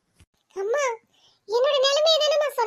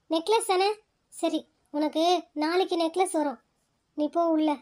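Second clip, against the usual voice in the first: lower, gentle brother soothing his sister; a gentle lowered male voice, reassuring; calm older brother; 6 semitones lower than usual. Acted. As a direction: settle her down like a patient elder brother.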